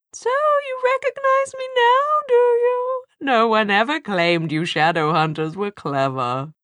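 very high, sneering demon screech; high mocking demon voice. A narrator's reading. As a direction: taunt like a shrill demon. Demon character voice.

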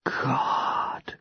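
hushed, breathed-out murmur; soft gasp of pleasure under the breath; low voice. A narrator's reading. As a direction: say it very softly, almost whispered. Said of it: "Claire says in a low voice".

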